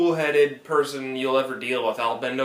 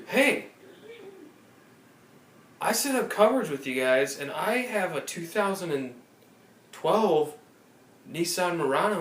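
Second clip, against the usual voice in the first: caller voice; 6 semitones higher than usual. Retold or imitated. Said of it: "they're like".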